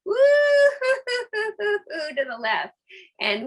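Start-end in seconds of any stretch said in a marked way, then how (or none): 0.0-2.1 high, slow, loud woo-hoo; playful high woo-hoo; hula hoop whoop. Shown as a demonstration. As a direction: whoop it out high while swinging the hips. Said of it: "it's gonna be".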